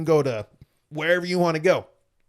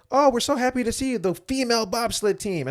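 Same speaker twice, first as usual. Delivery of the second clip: mock gushing welcome; 9 semitones higher than usual. Mocking gushing media people.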